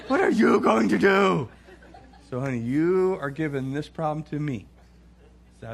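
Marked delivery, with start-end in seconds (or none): 0.1-1.5 slow, high, loud mocking wife with a laugh. Wife throwing the problem back at husband, mocking. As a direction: stretch it out, laughing in her voice.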